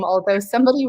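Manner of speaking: normal talking voice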